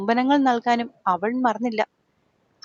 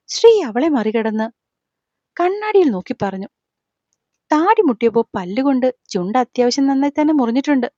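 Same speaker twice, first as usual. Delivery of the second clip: plain reading voice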